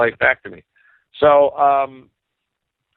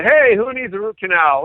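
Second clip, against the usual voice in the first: higher, hammed-up hearty call; mock party invitation; 9 semitones higher, 4 dB louder than usual. Dentist to colleagues at a barbecue, mock-eager calling out.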